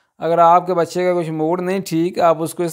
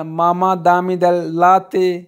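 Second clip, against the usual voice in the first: slow teaching demo; much slower than usual. Shown as a demonstration. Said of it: "teacher models Italian very slowly".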